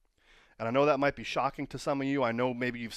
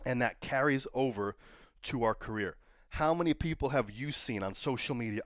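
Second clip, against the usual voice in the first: usual speaking voice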